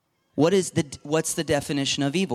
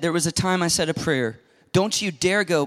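ordinary talk, nothing marked

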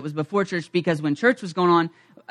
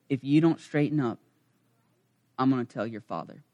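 low, quiet stern elder warning; a hushed stern threat to a kid; real serious; 7 semitones lower, 9 dB quieter than usual. Retold or imitated. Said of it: "looked at me real serious".

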